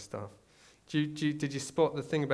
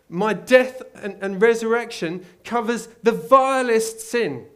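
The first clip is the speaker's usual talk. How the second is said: high, loud impassioned sermon; full-force preaching; 10 semitones higher, 11 dB louder than usual. Real, not acted. Preacher proclaiming forgiveness to the congregation, loud high fervour. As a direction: preach it with everything you've got.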